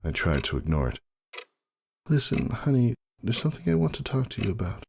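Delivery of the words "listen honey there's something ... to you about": higher, gentle sick mare; Roseluck's voice. A narrator's reading. Roseluck apologizes to Ember from her hospital bed.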